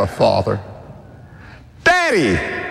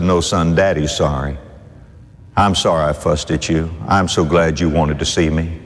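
tender apology; 13 semitones lower than usual. Acted. Father apologises tenderly to son.

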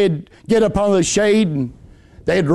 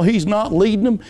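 ordinary talk, nothing marked

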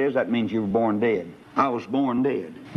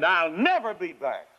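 higher, huffy parting shot; defiant retort; 6 semitones higher than usual. Acted. Sinner storming out on Doctor Law, higher defiant retort. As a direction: slam the door with your voice.

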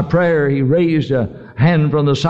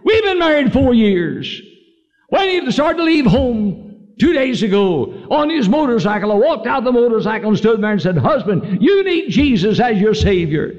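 high-pitched retelling of an overwrought woman; retold woman's outcry; 7 semitones higher than usual. Widow pours out her story to the preacher by telephone, high retold outcry. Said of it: "she screamed".

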